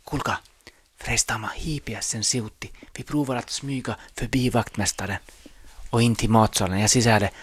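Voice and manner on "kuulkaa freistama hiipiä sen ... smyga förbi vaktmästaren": hushed conspiratorial whisper; sneaky whisper. Pretend. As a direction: whisper it so the janitor can't hear. Sneaking past the janitor. (hiipivä kuiskaus)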